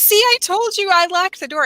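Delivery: high-pitched son insisting he's right; mock a defensive son's I-told-you-so; retold protest. Son's vindication acted out.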